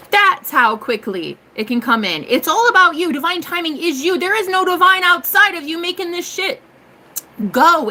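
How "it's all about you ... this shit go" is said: fired-up pep-talk yell. Real, not acted. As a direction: raise your voice and drive each phrase home. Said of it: "I really should stop yelling at you".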